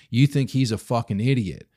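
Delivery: ordinary talk, nothing marked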